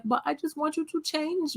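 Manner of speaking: put-on high little-kid voice, pleading; toddler voice. Mom imitating her toddler son, high childish pleading. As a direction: play the whiny toddler asking for a change. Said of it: "he's like".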